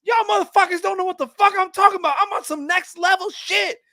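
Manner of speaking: very high, loud comic rant; anger translator. Retold or imitated. Translator voicing Obama's hidden anger, mock-furious. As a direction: screech it fast as a hammed-up furious sidekick. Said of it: "the Anger Translator".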